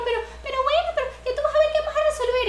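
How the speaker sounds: very high mock friend reassuring; mimicking the friend. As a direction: play the friend insisting it will be fine. Friend voiced in a story.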